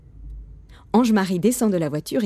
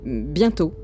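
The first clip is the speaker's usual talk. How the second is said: low, hesitant reply; bothered father; 3 semitones lower than usual. A narrator's reading. Papa dodging his son's question, bothered and hesitant. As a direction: put the child off, unsure. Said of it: "fait papa embêté".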